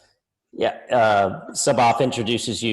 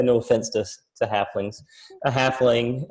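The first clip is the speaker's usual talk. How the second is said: ordinary delivery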